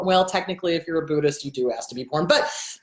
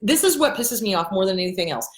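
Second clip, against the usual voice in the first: normal talking voice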